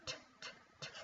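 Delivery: whispered T, t, t before "tiger"; pronunciation. Shown as a demonstration. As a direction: breathe the "T" sounds softly. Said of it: "Repeat after me".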